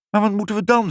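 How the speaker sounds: high-pitched, mildly despairing question. A narrator's reading. Mother facing a kitchen full of fries, despairing. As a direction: ask high, at your wits' end. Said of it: "zei moeder wanhopig".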